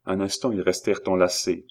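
plain reading voice

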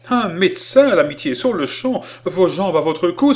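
urgent cry, high and loud; exclaiming. A narrator's reading. Colonel sending urgently for a doctor, crying out. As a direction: cry out the order, fast at first, high and forceful. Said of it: "il s'écria".

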